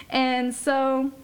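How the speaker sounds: usual speaking voice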